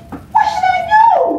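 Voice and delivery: very high, loud, quick plea; a girl flustered by a boy, asking her friend for help; flustered question. Acted. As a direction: squeal it out in a fluster. Girl asks friend, alarmed.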